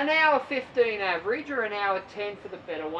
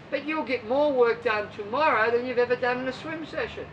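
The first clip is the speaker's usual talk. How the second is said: normal talking voice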